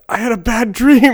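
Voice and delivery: high, loud, hammed-up wail; exaggerated tearful cry; mock bawling. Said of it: "then I just started bawling".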